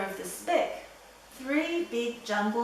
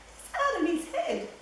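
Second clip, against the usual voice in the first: mocking gossip; 10 semitones higher than usual. Jungle animals gossiping.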